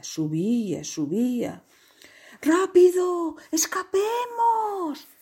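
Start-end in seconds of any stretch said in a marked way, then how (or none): 2.4-5.1 high-pitched cry of alarm, stretched out; urgent shouting. A narrator's reading. Animals yelling to each other in the flood, shouting. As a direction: call out to flee. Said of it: "gritaban todos".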